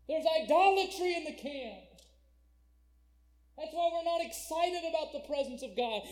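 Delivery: loud, high-pitched sermon cry; full-force preaching. Acted. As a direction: thunder it out to the congregation.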